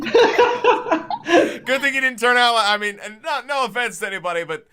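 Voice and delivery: high, loud laughing talk. Real, not acted. Joking with friends about the brother, laughing.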